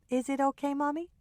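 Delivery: put-on high, small child's question; little girl asking. A narrator's reading. Daughter asking her mother, high child's voice. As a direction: plead gently like a young girl.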